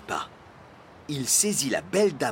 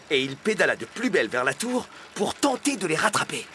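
plain reading voice

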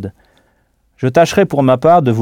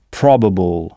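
probable, first syllable struck; stress on PRO; 5 semitones lower, slower than usual. Shown as a demonstration. Teacher demonstrating English stress on probable. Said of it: "On frappe en quelque sorte sur cette syllabe".

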